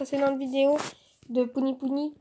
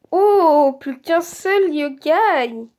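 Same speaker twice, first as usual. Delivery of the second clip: loud, very drawn-out exclamation; excited exclamation; 6 dB louder, much slower than usual. Real, not acted. Gamer finding one yokai left, excited drawn-out cry. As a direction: stretch it out in real excitement.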